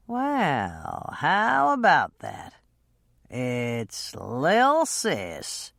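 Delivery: put-on a drunk man sliding into a scene. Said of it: "stumbling slightly".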